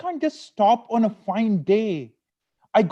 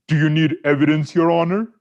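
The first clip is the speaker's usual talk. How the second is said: louder, quicker mock courtroom jab; husband mocking his wife's cross-examination, louder and faster; sarcastic retort; 2 semitones lower, 6 dB louder, faster than usual. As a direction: needle her like a lawyer in court. Sarcastic retort to nagging wife.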